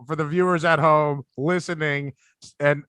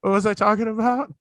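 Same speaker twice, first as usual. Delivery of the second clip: blanking nervous comic; 4 semitones higher than usual. Retold or imitated. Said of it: "I'm just like".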